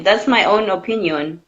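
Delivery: normal talking voice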